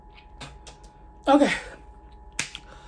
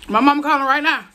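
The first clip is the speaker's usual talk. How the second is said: louder and higher, calling out; 6 semitones higher, 6 dB louder than usual. Real, not acted. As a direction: raise the voice, pleading for mama. Burning mouth, calling for mama.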